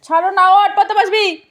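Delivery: sharp parental telling-off; stern scolding. Real, not acted. Mother orders child up to study.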